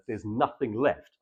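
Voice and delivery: normal talking voice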